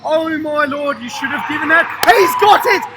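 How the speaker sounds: high, building to a loud shout; astonished commentary. Real, not acted. Commentator amazed by a save, astonished shout. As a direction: exclaim in disbelief, rising to a yell.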